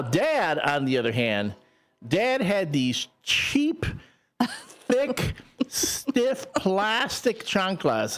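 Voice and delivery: normal talking voice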